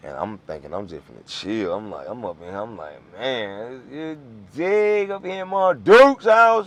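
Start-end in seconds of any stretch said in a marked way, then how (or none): 3.1-6.7 drawn-out, high and loud retold cheer; excited exclamation. Storyteller recalling his arrival at the house, loudly excited. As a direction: stretch it out, pitched high and loud.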